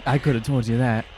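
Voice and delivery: ordinary talk, nothing marked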